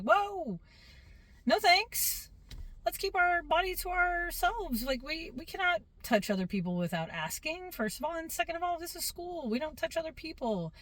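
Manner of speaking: high-pitched classroom reprimand; teacher correcting kids. Retold or imitated. Teacher correcting child in story.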